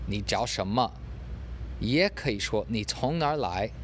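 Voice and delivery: usual speaking voice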